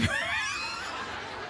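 high airy laugh bursting out; real burst of laughter. Real, not acted. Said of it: "You see this laugh where I laugh".

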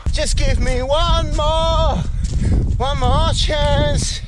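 drawn-out, slow plea; slow pleading. Real, not acted. Pleading while struggling uphill.

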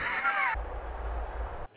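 soft, very high voiceless laugh; high wheezy laugh. Real, not acted. Gamer reacting to the game, wheezy laugh.

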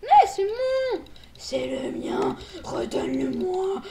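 loud, outraged shout; mock angry yell. Player yelling at friend to give the item back, mock outrage.

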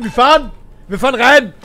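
loud announcement that it's moving; excited shout. Real, not acted. Gamer as the train finally drives in, excited shout.

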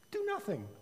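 a hint of a calm queen; queen's voice. A narrator's reading. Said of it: "the queen suggested".

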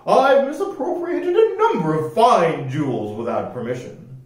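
high, prim and proper voice; un-piratelike rascal. A narrator's reading. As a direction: explain it very properly, pitched high. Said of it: "said the rascal".

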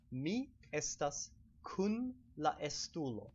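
slow, careful Esperanto model sentence. Shown as a demonstration. Teaching viewers an Esperanto sentence, slow and careful. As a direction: spell it out slowly for learners. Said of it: "So to say".

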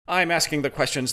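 a mild snap, curt; snapped. Acted. Interrogator cuts off Amy, a mild snap. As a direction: cut her off, understated anger. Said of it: "the man snapped".

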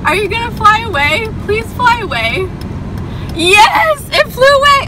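high, loud shrieking relief; relieved panic. Real, not acted. Driver shrieking with relief as bird leaves. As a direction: squeal as it finally flies off. Bird finally flies away.

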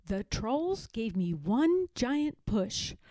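ordinary delivery, nothing marked

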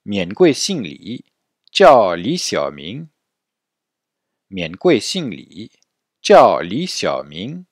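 slow polite Mandarin answer; model for repetition. Shown as a demonstration. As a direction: say "Mian Gui Xing Li, Jiao Li Xiaoming" slowly. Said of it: "Donc répétez après moi". (modèle lent à répéter)

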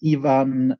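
ordinary talk

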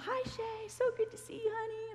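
very high, sweet imitated voice; sweet cheerful greeting. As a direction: coo the welcome up high like a doting old lady. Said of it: "she's totally different spirit about her".

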